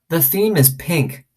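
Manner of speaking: put-on high, wide-eyed small girl, slowed down; awed little girl asking about magic. A narrator's reading. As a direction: marvel at it like a little kid. Little girl Sweetie Pie.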